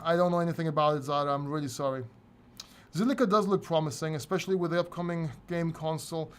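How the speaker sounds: usual speaking voice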